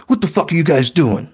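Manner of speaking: imitating an angry maintenance guy. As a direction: play the pissed-off maintenance guy at the door. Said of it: "He's pissed off".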